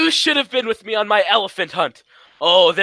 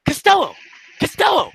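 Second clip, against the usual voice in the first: each Costello! called louder and higher; calling out; 5 semitones higher, 4 dB louder than usual. Acted. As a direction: yell for him, climbing each time.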